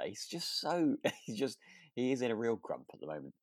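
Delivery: normal talking voice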